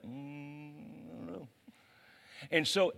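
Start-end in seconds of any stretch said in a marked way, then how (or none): 0.0-1.5 soft, offhand brush-off, a lot softer; dismissive shrug. Acted. Jesus brushing off false friends, soft dismissive murmur. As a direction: murmur it like you barely know them. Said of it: "And Jesus is like".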